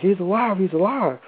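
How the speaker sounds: high, urgent re-enacted exclamation; retold urgent realization. Seeing his leg moving, retold urgent realization. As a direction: pitch it up in retold astonishment. Retold discovery the officer moved.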